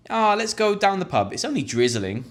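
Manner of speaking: high breezy invite; casual pub invite. Shown as a demonstration. Friend urging a mate to the pub in drizzle, high and breezy. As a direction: shrug off the rain.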